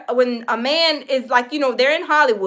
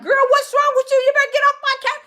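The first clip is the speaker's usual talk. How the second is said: high, quick mock scold at a friend; imitated scolding; 11 semitones higher, faster than usual. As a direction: squeal it fast and high, half outraged.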